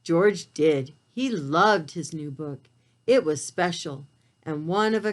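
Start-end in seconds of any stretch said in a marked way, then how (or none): none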